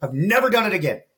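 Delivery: high, loud, thrilled telling; excited. Real, not acted. Excited about sobriety.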